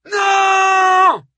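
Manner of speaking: long, high shouted refusal; war cry. A narrator's reading. The women of Troy answer the call, a war cry. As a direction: roar it out and hold it. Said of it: "ce cri de guerre se prolongea".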